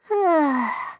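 long, falling sigh; weary sigh. A narrator's reading.

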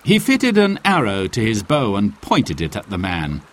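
ordinary delivery

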